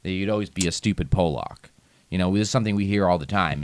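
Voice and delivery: ordinary talk, nothing marked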